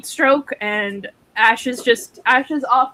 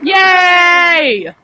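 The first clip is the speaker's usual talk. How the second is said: excited cheer; 6 semitones higher, 5 dB louder than usual. Real, not acted. Cheering a Wonder Woman pick.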